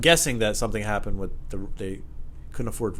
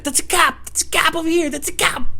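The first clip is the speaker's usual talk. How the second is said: high, loud urgent pointing voice; imitated paranoid neighbor; 5 dB louder than usual. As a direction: warn of cops frantically. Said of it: "And he's like".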